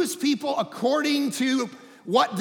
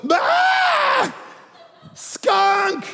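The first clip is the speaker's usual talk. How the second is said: high, loud panicked yell; frightened scream; 6 dB louder than usual. Retold or imitated. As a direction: shriek as if you saw a skunk.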